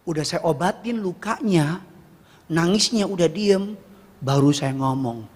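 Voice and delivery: ordinary talk, nothing marked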